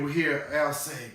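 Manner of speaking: ordinary delivery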